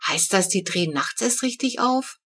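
ordinary delivery, nothing marked

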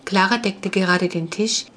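ordinary delivery, nothing marked

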